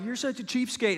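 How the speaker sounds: put-on high-pitched kid's jeer at dad; mock childish accusation; complaining kids. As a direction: imitate a kid needling their dad. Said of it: "your kids are like".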